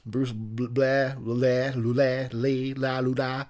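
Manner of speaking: "blah" loosened into leh, luh-leh, lee; nonsense name variations. Shown as a demonstration. Riffing on last-name variations, "blah" garbled into nonsense syllables. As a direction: babble "blah, la, lu, da" as made-up surnames, on a level pitch. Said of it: "any variation you can think of".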